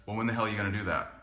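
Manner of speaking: low, quick, soft challenge; quiet, unsmiling dare; deadpan challenge. Retold or imitated. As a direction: say it dead-on, no smile. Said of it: "looked at him dead in the eyes".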